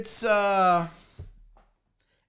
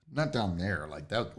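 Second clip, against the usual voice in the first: much lower and softer; low aside; 12 semitones lower, 10 dB quieter than usual. Pretend. Host correcting his own innuendo to viewers, low aside. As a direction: drop into a low, quiet murmur.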